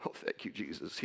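whisper-thin tearful gratitude; tearful thanks. Real, not acted. Father hearing the news broadcast, tearful and soft.

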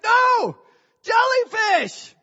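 high, loud, drawn-out yell; acted-out warning cry, full volume; alarmed shout. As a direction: holler the danger across the water. Friend yells answer across water.

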